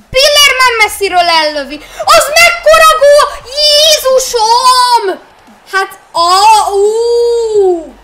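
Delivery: screaming, loud and high in real joy; ecstatic shout. Real, not acted. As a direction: yell in genuine disbelief at the goal. Real thrill at long-range goal.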